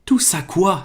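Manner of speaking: Harry, baffled, asks back; puzzled. A narrator's reading. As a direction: ask it in honest confusion. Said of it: "s'étonna Harry".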